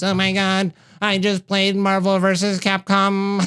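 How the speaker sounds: high, slow nerdy gamer voice. Acted. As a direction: gush like an excited nerd.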